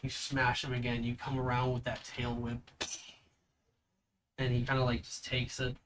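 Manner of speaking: normal talking voice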